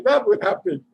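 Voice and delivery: laughing hard, high pitched; real laughter. Real, not acted. Storyteller laughing at the imagined disgrace, real laughter. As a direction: crack up at the thought.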